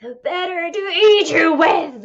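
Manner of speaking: high, loud snarl; bare the teeth and snarl it out; wolf snarling. A narrator's reading. Wolf leaping out of bed at the girl, loud snarl. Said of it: "the wolf snarled".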